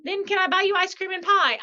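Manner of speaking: eager husband offer. Retold or imitated. Husband offering ice cream and pie, high doting voice.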